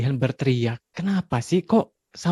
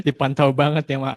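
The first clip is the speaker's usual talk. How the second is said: ordinary talk, nothing marked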